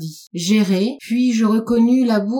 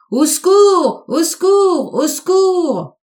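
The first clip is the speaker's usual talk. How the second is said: high, loud cries, the last one slower and drawn out; desperate cry for help; 9 semitones higher, 5 dB louder, slower than usual. A narrator's reading. Lost man screams for help in the empty night, desperate. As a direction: call out into the dark street, pleading. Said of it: "Je hurlai".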